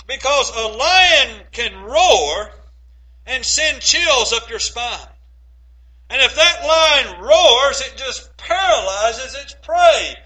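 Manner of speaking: slow, heavy, rising preaching; building sermon intensity. Acted. Preacher warning the church of the lion's roar, slow and building. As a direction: lean into each word, let it swell.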